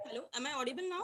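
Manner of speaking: faint call audio; barely audible. Real, not acted. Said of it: "no, ma'am".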